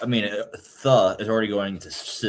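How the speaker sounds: ordinary talk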